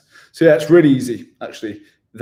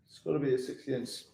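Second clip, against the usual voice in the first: softer, quick repeat to himself; a quick, much softer repeat, half to himself; quiet fast aside; 12 dB quieter, faster than usual. Real, not acted. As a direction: mutter it again under your breath, quickly. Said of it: "mate, it's gotta be a sixty inch".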